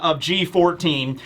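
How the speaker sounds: ordinary talk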